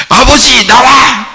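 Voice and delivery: mock angry shout. Younger son storming home orders father out, mock angry. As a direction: act out storming in and calling out. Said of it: "하면서 막 승질내면서 들어와요".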